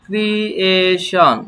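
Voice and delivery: ক্রিয়েশন said slowly as kri-e-shon, syllable by syllable; teacher modelling ক্রিয়েশন slowly for students; slow model word. Shown as a demonstration. Teacher to students, slow careful pronunciation. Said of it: "teacher models pronunciation".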